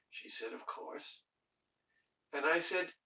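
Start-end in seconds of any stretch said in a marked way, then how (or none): 0.5-1.2 whispered, much softer "Of course"; hushed reply. Retold or imitated. Client quietly agreeing to a question, whispered. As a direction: breathe it out, barely voiced.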